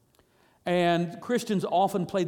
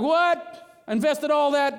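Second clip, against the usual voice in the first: high-pitched, incredulous protest; hammed-up disbelief; mock indignation; 7 semitones higher, 3 dB louder, slower than usual. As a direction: act a reader who feels cheated. Acted reader outraged by the ending.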